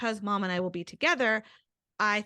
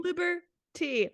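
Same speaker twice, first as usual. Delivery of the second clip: high, slow, drawn-out pun; cutesy high-pitched stretch on both halves of "Liberty"; cutesy repeat; 9 semitones higher, slower than usual. Acted. Repeats cute pun in delight.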